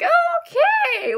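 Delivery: very high, loud, wary okay; uneasy acceptance. Retold or imitated. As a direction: accept it doubtfully, pitch shooting up. Reacts to scary-looking new inmate.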